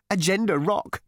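high, trilling little correction; happy trill. A narrator's reading. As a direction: trill it gleefully. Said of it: "trilled the hairdresser happily".

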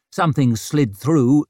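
plain reading voice

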